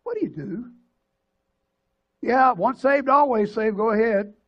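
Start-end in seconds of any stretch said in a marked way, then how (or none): 2.2-4.3 loud, sarcastic permission; preacher mocks the flippant reply he could have given; mock careless answer. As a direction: hammer home how hollow the easy answer is. Mocking a careless pastoral answer.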